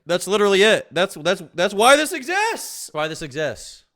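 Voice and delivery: loud, high-pitched insistence; worked-up shouting. Real, not acted. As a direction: hammer the point home at a yell. Worked-up rant about the real conspiracy.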